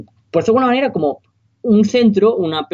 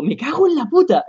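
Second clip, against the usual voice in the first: stunned curse; 9 semitones higher than usual. Retold or imitated. Retold shock at recognizing friend.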